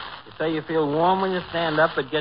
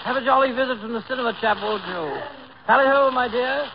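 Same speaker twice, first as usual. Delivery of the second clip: high, exaggerated English send-up; hammed-up mocking British farewell; mock British accent; 5 semitones higher than usual. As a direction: parody his British toff act right back. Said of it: "a fellow with a British accent".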